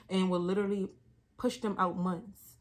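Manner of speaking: usual speaking voice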